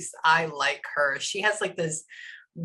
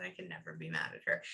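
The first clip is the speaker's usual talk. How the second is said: very soft, much lower voice; channeled man's reassurance; 14 dB quieter, faster than usual. Retold or imitated. Medium relays that he can never be mad, low murmur. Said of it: "he said".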